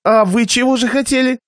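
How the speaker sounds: высокий, напряжённый голос хозяина; спроси с натянутой вежливостью; раздосадованный хозяин. Acted. Хозяин едва сдерживает досаду. (сдержанная досада)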